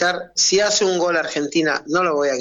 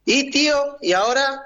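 voz más aguda imitando al sobrino; sobrino bromista; 8 semitones higher than usual. Retold or imitated. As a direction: pregunta citada en tono agudo, ligeramente actuada. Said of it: "hablo con uno de mis sobrinos". (imitando al sobrino que lo carga, en español rioplatense)

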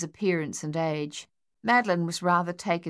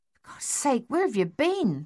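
higher, startled soldier's question; startled exasperation; 6 semitones higher than usual. A narrator's reading. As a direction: blurt it out, rattled. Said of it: "Dave asked".

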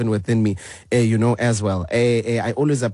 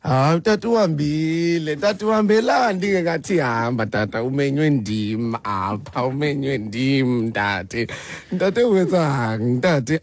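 playful protest; 4 semitones higher than usual. Real, not acted. Father left call, host protests playfully.

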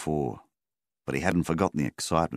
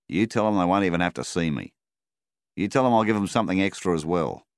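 lightly voiced farmer, louder and pressing; a hint of a farmer pressing his point on the phone, louder; firm farmer on the phone; 3 semitones higher, 5 dB louder than usual. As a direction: push the deal down the line, louder. Milvin insisting on the phone.

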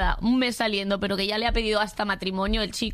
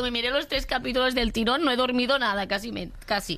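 ordinary delivery

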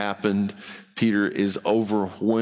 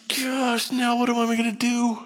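higher, pleading "now what am I gonna do?"; exasperated high inner voice; 12 semitones higher than usual. Retold or imitated. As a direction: voice jumps up in mock despair. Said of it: "And I'm like".

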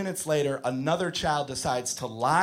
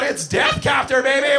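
put-on kid yelling; 8 semitones higher, 6 dB louder than usual. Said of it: "he yells out".

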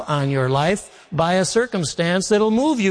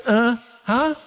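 put-on higher, questioning "huh?" of a kid stalling mom; feigned not hearing; 6 semitones higher than usual. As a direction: play hard of hearing with a rising pitch. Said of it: "I'd always say to my mom".

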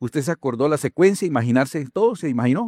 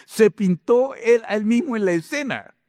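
laughing; 7 semitones higher than usual. Real, not acted. Laughing at Rembrandt's trick.

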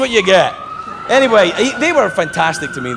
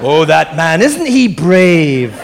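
slow, drawn-out pitying coo; mock admiration; 4 dB louder, much slower than usual. Viewer admiring the brave man, mock-pitying. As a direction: stretch it out with loud fake sympathy. Mock pity for stroke victim.

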